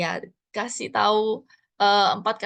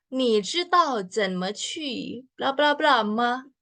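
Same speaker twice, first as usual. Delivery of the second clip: slow demo; 3 semitones higher, slower than usual. Shown as a demonstration. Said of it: "kalian bisa lihat di sini".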